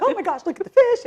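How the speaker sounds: high, loud delighted squeal; freaking out. Retold or imitated. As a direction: gush over the fish like a thrilled kid. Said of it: "like, freaking out".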